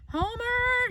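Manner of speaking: high alarmed yell for the men; cried out. Acted. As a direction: call the men from the kitchen window. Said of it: "she cried".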